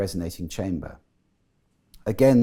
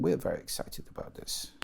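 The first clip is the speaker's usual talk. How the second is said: flat Scandinavian mimic; 5 semitones lower, 8 dB quieter than usual. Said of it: "you'll get people saying".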